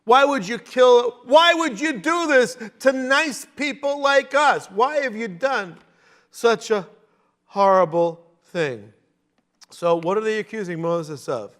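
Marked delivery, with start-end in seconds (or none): 0.0-8.9 mock whine. Israelites whining at Moses.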